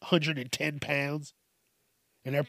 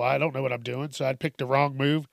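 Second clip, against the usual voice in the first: normal talking voice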